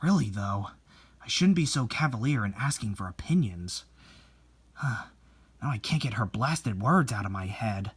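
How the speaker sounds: low, soft bitter brooding to himself; brooding mutter. Acted. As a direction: grumble it under your breath, stewing. Painter broods alone afterward.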